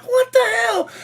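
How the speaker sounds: squeaky high disbelief; you've got to be kidding; incredulous. Retold or imitated. Watching the kid get served first, in disbelief. Outraged at egg roll favoritism.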